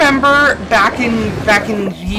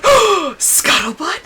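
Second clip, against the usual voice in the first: high, gasping mock excitement; imitated excitement; 8 semitones higher, slower than usual. Coworker hearing of fresh gossip, imitated eager excitement. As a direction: perk right up in mock delight. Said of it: "And she's like".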